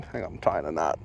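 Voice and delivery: words squeezed through clenched teeth; talking through teeth. Real, not acted. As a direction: talk through teeth while tying a knot. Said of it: "That's why I'm talking through my teeth".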